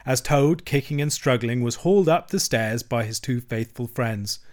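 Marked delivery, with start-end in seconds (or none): none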